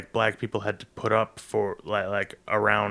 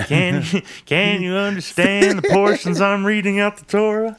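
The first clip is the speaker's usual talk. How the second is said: high, loud mock rabbi voice; higher and louder, a teasing synagogue voice; 11 semitones higher, 8 dB louder than usual. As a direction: ham up a rabbi checking your Torah reading. Mock rabbi reading the Torah.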